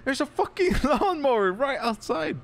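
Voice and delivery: high, laughing outburst at the lawnmower; can't believe the timing, laugh through it; laughing disbelief. Real, not acted. Lawnmower noise interrupts recording.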